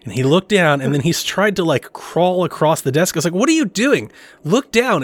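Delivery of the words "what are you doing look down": high incredulous retold call; mock frustration at his son, pitched up; exasperated. Father retelling how he pleaded with his son, exasperated.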